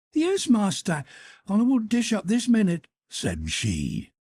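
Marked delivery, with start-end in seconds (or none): 0.1-2.9 higher, bright servant's reply; Gretel's eager reply. Acted. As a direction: answer the master all innocence. Gretel answering her master.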